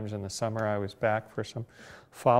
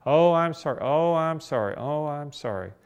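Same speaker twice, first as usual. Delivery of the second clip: higher, louder, repeated fretful apology fading down; gushing apology; 5 semitones higher, 6 dB louder than usual. Retold or imitated. Stewardess chasing the passenger to apologise, gushing apology. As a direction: plead for forgiveness, over and over.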